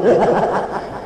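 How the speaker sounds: high chuckle; short high laugh, falling at the end. Real, not acted. Speaker laughing at his roadside collection joke, high chuckle.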